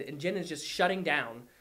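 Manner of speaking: ordinary delivery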